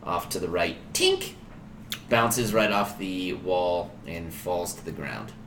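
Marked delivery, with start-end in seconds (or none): 0.9-1.3 very high, short "tink"; tiny high-pitched impact noise; metallic clink. Acted. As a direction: mimic a dagger pinging off stone. Imitates dagger hitting the wall.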